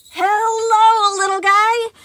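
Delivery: high, loud, overjoyed hello; loud greeting. A narrator's reading. Animatronic greeting a scared boy loudly, high and thrilled. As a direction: boom a delighted hello at the kid. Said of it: "the thing greeted loudly".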